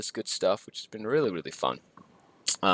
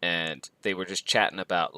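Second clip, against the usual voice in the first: normal talking voice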